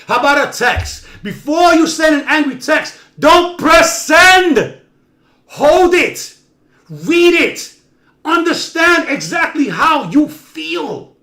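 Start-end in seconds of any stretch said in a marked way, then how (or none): 1.2-11.2 emphatic. Real, not acted. Urging listeners, emphatic advice.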